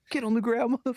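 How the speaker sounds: high-pitched imitated police yell; mock screaming. Imitating an officer who drew his gun, mock screaming. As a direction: yell a mock order, high but not full volume. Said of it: "And screaming".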